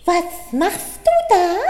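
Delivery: high, lisping little bunny voice; slushy lisp. A narrator's reading. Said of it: "lispelte es von der Seite".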